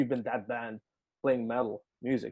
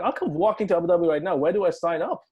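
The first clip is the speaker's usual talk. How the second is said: higher, louder, all eagerness; retold eager acceptance; 5 semitones higher, 5 dB louder than usual. Musician accepts the Abu Dhabi gig, high and eager. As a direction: grab the chance with a lifted voice.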